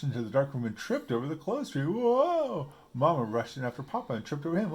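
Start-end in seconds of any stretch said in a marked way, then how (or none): 1.8-2.7 high surprised whoa while falling; startled yelp. A narrator's reading. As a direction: yelp as you trip. Papa trips over clothes tree.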